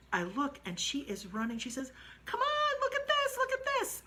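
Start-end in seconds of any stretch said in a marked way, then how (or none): 2.2-4.0 very high, loud squeal; delighted squealing friend. Retold or imitated. Jenny calling the narrator to see the horse, delighted high squeal.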